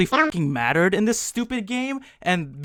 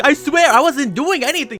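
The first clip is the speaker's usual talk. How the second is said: higher, sheepish anime denial; flustered denial; 8 semitones higher, 3 dB louder than usual. Retold or imitated. Takeru caught staring at Shizuka, higher flustered denial. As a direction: protest innocence like a caught anime boy.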